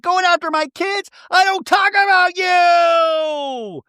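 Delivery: high, loud, very slow yelled outburst; mock shriek. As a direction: stretch the last line into a drawn-out scream. Tamara's outburst, retold.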